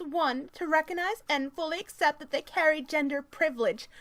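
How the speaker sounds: high, loud mock-activist reading voice; strident article read. Reading a feminist article aloud to mock it, strident.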